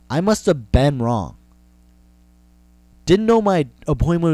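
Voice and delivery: angry rant. Acted. Comic rant at the vet.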